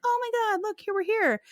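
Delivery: high, light gushing voice; mock excited arrival. Talia arriving to meet the king, mock excited.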